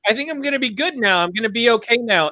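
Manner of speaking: high, hopeful relieved voice; relieved patient. Retold or imitated. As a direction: say it lifted and relieved. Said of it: "He's like".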